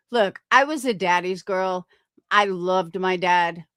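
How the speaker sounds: ordinary talk, nothing marked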